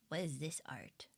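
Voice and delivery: soft unvoiced whisper. Real, not acted. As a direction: whisper it to yourself. Reading something on Twitter.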